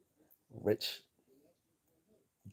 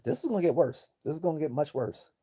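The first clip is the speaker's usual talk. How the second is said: heated warning; 8 semitones higher, faster than usual. Real, not acted. Streamer warning viewers about the scandal, high urgent warning. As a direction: sound the alarm, building conviction. Warns the scandal will grow.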